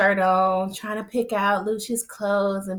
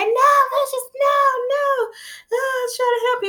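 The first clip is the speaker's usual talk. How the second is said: very high, squealing imitation of begging; mock desperate wail. Retelling Tracy begging as she is thrown out, mock desperate wail. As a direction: wail out the protests in a put-on high voice.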